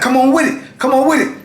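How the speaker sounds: high-pitched retold bravado; braced fighter's call, acted out; daring challenge. Braced, daring them to come.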